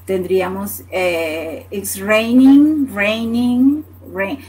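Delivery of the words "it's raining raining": raining said slowly and louder, with a clear NG at the end; slow loud demo. Shown as a demonstration. Teacher drilling raining for the class, slow and loud. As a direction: stretch out raining and land the final NG. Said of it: "Y N G al final".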